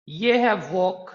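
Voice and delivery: slow, drawn-out वॉक; walk demo. Shown as a demonstration. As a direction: stretch वॉक slowly with no ending sound. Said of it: "ड की जगह पर मेरे मुंह से ठ का साउंड".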